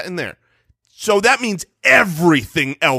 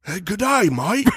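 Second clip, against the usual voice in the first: put-on Australian, a bit softer; Australian accent; 3 dB quieter than usual. Co-host asked for an Australian, playful Australian accent. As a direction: greet like an Aussie mate. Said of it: "give me an Australian".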